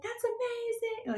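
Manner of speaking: high, slow exaggerated mouthing; mouthing praise through a window; mouthed admiration. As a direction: overdo the lip shapes behind glass. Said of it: "they'd mouth it with their mouth".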